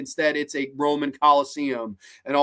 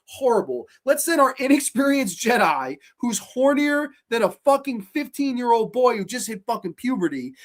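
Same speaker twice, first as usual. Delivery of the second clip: usual speaking voice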